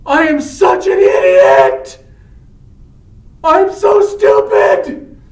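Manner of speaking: very high, very loud self-reproach; acted anguished shouting. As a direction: wail out horror at your own deed. Romeo realizes he killed Tybalt.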